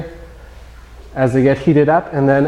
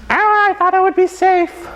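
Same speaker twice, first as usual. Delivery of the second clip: high, strained villain's last cry. Acted. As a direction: squeal it out as the doomed villain. Villain's dying cry, voiced.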